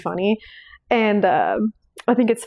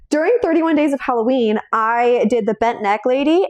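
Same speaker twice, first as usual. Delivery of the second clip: ordinary talk, nothing marked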